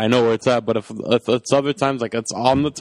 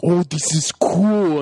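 slow, higher action-star impression; Schwarzenegger; 6 semitones higher, much slower than usual. Host mocking the actor's kid-movie acting, hammy impression. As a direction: put on a drawn-out, badly acted movie dad voice. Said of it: "he's like".

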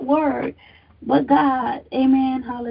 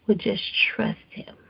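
hushed whisper, no voice; 3 semitones lower, 5 dB quieter than usual. Real, not acted. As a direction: drop to a breathy whisper. Whispered phrase in sermon.

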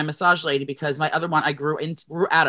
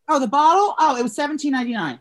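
surprised realization; 10 semitones higher, 4 dB louder than usual. Real, not acted. Sudden realisation of misunderstanding.

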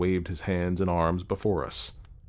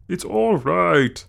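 high, loud, drawn-out reassurance; confident sceptic Evans; 10 semitones higher, 7 dB louder, much slower than usual. A narrator's reading. Evans brushes off Wish's objection, loudly confident. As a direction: wave the worry away, loud and slow.

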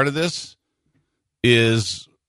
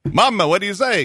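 higher, hammed-up Italian voice; mock Italian, pitched up; fake Italian accent; 7 semitones higher than usual. Shown as a demonstration. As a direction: put on a cartoon Italian accent. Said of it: "do the fake Italian voice".

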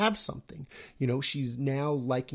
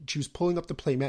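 usual speaking voice

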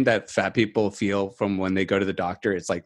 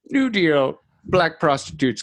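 mock pompous voice; 6 semitones higher, slower than usual. Mocking again.

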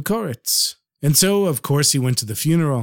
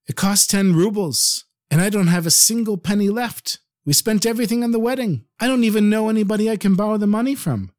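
higher-pitched protest of poverty; pleading protest; 7 semitones higher than usual. Acted. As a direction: plead that there's no money, pitch raised. Rabbi pleads poverty to groom.

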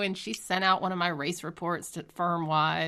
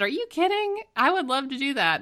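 thrilled exclamation; 9 semitones higher, 4 dB louder than usual. Retold or imitated. Retold thrilled reply to keynote offer.

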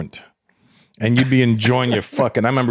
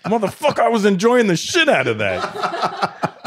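laughing outrage; 11 semitones higher, slower than usual. Retold or imitated.

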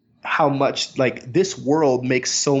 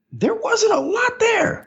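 much higher, drawn-out words; high-pitched admission; slower than usual. Real, not acted. Cohost admitting the violence was mild, high and drawn out. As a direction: squeak it out slowly, up high. Frank verdict, put-on high voice.

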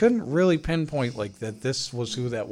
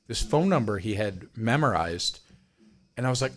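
normal talking voice

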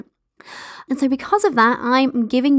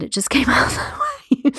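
giggly whisper; 4 semitones higher than usual. Real, not acted. Giggling at her own accent.

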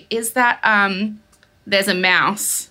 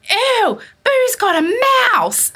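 high, disgusted cry of alarm; imitated alarmed partner; 13 semitones higher, slower than usual. Partner spots the cat with a mouse, alarmed cry. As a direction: mimic a sudden squeamish outburst. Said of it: "all of a sudden he goes".

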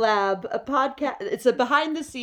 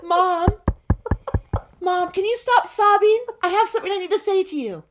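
put-on demanding child; 8 semitones higher, 7 dB louder than usual. Child to sobbing mom behind door, high insistent whine. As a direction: act the self-absorbed kid demanding Mom's attention. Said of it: "I just would gently knock on the bathroom door".

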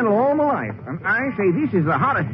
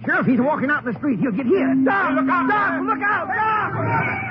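alarmed shouts, much higher; shouting a warning; 9 semitones higher than usual. Acted. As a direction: yell at Doc to look out for the car. Warning a man in traffic.

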